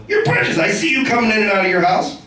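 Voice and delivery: high-pitched mock indignation; accusing neighbour. Neighbour confronting the comedian about his house, mock accusing. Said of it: "He goes, uh".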